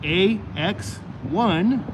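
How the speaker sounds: slow, careful letter by letter; slow spelled out. Shown as a demonstration. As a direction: spell it out slowly for viewers. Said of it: "like the number one numeric".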